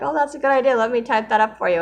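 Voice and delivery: high, bright-eyed eager friend, quick on the second line; friend's eager reply. Retold or imitated. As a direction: mimic the friend jumping on the idea. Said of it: "Like, I share, like".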